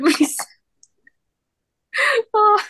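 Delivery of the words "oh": normal talking voice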